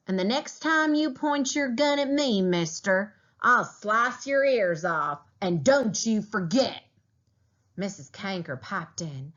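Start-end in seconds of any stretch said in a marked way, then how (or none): 0.1-6.8 high, menacing, slowed threat; angry threat. A narrator's reading. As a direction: warn him with real fury. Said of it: "He was so mad".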